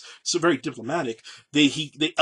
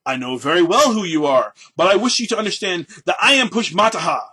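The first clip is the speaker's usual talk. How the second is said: loud, higher, haughty mimicry of the general; mocking his opponent; 5 semitones higher, 8 dB louder than usual. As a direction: throw the pompous tone right back at him. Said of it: "imitating the manner of his opponent replied".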